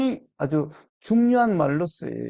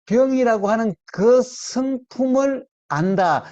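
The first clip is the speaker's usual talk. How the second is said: ordinary talk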